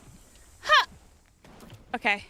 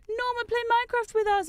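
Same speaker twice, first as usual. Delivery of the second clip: put-on kid brothers; 11 semitones higher than usual. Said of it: "they'd be like".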